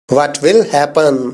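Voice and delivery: slow, careful English sentence; slow model reading. Shown as a demonstration. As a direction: say each word slowly and clearly. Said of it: "teacher says sentence slowly".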